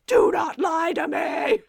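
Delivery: slow, high, loud mock roar; hammed-up furious accusation, drawn out; furious Klaus shout. Klaus roaring at his lying brother, hammed-up furious shout. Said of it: "I can't do it without sounding hilarious".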